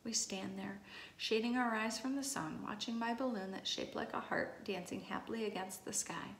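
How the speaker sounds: ordinary delivery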